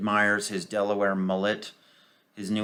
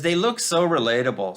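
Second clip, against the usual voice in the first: sarcastic; 6 semitones higher, 4 dB louder than usual. Retold or imitated. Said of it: "Janice says".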